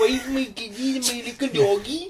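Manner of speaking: high, naive put-on voice; deluded film believer. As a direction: play a hopeful simpleton.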